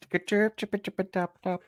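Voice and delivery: high chirp noises; high, quiet mock chirps; silly chirping. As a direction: chirp like a bird to dodge. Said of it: "That's my get out".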